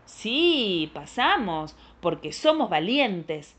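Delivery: put-on loud, swooping children's reply; emphatic storybook bravado; bold brave answer. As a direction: declare it proudly, punching each phrase.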